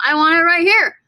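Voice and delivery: loud, high, stress on "here"; teacher insisting on the stressed syllable; emphatic. Shown as a demonstration. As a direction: punch "here" hard and high. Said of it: "I don't want the accent".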